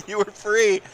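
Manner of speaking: high, laughing through the words; mock grief through laughter; laughing lament.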